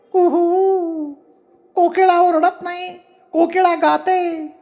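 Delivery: falsetto hooting, long held notes; cuckoo voice. A narrator's reading. Cuckoo correcting that it sings, falsetto hoot. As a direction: talk in a very high cuckoo pitch. Cuckoo call continued into argument.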